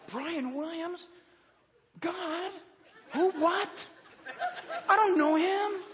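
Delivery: high, incredulous questioning; acted bafflement. Preacher waking at night to a stranger's name, acted high bafflement. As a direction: question it in high-pitched disbelief. Acts baffled reply to God.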